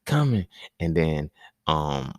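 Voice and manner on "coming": put-on kid warning. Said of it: "Riley like".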